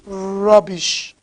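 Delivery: low, soft, disgusted aside; contemptuous dismissal. Real, not acted. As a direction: dismiss them with quiet contempt. Contemptuous dismissal after angry rant.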